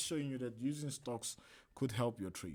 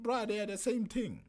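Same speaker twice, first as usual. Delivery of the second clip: exasperated; 9 semitones higher than usual. Retold or imitated. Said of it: "I'm like".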